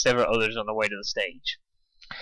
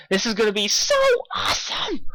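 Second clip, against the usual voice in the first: squeeing; 9 semitones higher than usual. A narrator's reading. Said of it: "Dash squeed".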